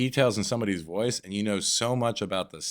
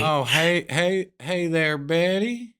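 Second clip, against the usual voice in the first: higher, slow stammering hello; stuttering bumpkin caller; 5 semitones higher, 3 dB louder, much slower than usual. Retold or imitated. Caller greeting grandma Betty with an awkward stutter. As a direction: stumble over the greeting, a bit higher. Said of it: "I put a little extra stutter".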